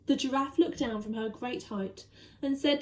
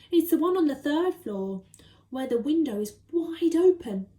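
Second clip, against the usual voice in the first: usual speaking voice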